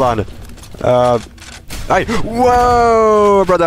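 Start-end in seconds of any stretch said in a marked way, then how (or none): 1.9-3.7 startled shout. Real, not acted. Player startled by an enemy, shouting in surprise. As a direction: caught off guard, yelp it out. Sudden surprise mid-fight.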